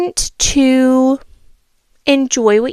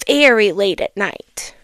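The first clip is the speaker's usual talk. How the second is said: storybook reading voice; a child reading a fable aloud; story narration. A narrator's reading. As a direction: read it like a bedtime story.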